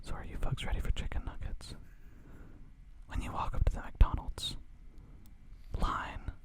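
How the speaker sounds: unvoiced intimate whisper; ASMR whisper. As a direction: hush it like an ASMR video. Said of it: "full A S M R action".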